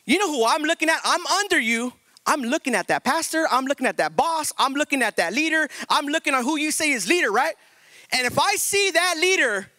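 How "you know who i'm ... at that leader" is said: ordinary talk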